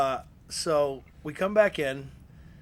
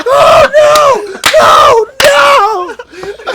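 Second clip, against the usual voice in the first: very high, loud laughing panic; panicked shout; 18 dB louder, much slower than usual. Retold or imitated. Narrator reacting to his partner cursing the boss, panicked laughing yell. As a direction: yelp in horrified laughter.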